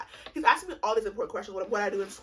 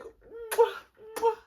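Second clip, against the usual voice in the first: two high mock air kisses; kiss sounds; 13 semitones higher, 4 dB louder than usual. Date greets her with two kisses, mock kiss sounds. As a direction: imitate high, loud cheek kisses. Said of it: "not the two kisses or whatever".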